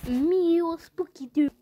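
normal talking voice

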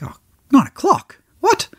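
mock-shocked double take; shocked disbelief. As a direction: act out your own disbelief at the late start. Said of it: "I'm like".

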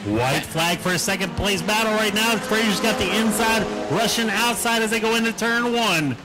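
motorsport commentator. Real, not acted. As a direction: call the last lap, building up. Calling the final lap.

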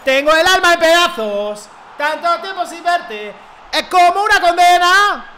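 high, slow, hammed-up heartbreak; mock lament. Streamer hamming up a heartbroken ballad line. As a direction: sing out a tragic lament.

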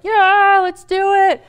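a friend egging her on to call; eager cheer. Retold or imitated. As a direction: jump at the idea with a bright yes.

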